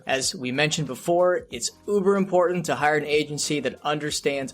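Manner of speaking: upbeat podcast ad read; sponsor ad read. Acted. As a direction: pitch the sponsor like a radio spot. Host reads sponsor ad.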